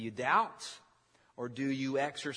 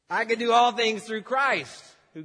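loud, high emphatic declaration; with feeling; 9 semitones higher, 10 dB louder than usual. Acted. Preacher as Josh agreeing with the Word, loud heartfelt declaration. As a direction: proclaim it boldly at full voice. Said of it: "With feeling".

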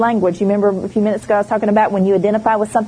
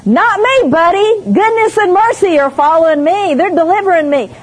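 bold comeback; 8 semitones higher, 4 dB louder than usual. Pretend. Imagined defiant retort to pastor.

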